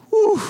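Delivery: big demonstrated whew of relief; sigh of relief. Shown as a demonstration. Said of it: "sigh of relief and say".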